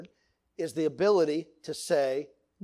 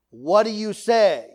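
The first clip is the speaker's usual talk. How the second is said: louder call-and-response question; dad's quiz call; 3 semitones higher, 6 dB louder, slower than usual. Retold or imitated. Father drilling kids on obedience, loud call. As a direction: bark it as the drill leader. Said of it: "dad quizzing kids".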